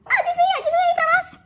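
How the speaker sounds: guess, guess, playful and bursting; teasing suspense. Real, not acted. Teasing the audience before revealing who was there, excited.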